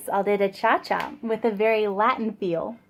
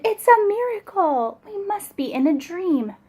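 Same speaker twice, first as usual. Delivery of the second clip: shouted; 8 semitones higher than usual. Acted. Animals amazed at Gerald's dancing, shouting. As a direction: cry out in wonder. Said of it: "They shouted".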